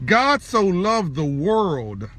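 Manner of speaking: drawn out at half speed; weighty, stretched-out scripture recital; slow, solemn preaching. Real, not acted. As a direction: let each word of the verse land slowly. Repeats the verse with weight.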